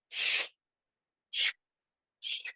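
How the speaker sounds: short hushed "shh" bursts; imitated tape noise. Salesman measuring a stranger's wall, imitated tape sound. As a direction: make the zip of a measuring tape with your mouth.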